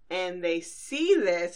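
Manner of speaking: normal talking voice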